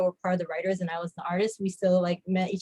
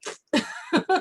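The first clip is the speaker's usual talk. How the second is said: laugh; 10 semitones higher than usual. Real, not acted. Laughs at toy company folding.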